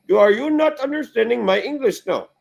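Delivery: high, loud retold telling-off; mock exasperation. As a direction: act out losing patience with a caller. Retelling his annoyed reply to telemarketer.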